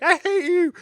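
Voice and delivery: hammed-up jealous wife; mock anger. Wife watching husband win, mock furious squeal. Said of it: "She's like".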